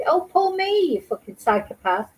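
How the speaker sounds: ordinary talk, nothing marked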